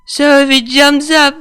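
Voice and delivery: put-on high, loud, slurring drunk mare. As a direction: play her sloppy and giddy drunk.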